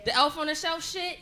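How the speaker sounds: normal talking voice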